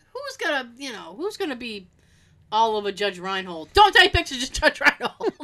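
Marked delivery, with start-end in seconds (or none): 3.7-5.3 mock scolding. Mimics someone forbidding photos.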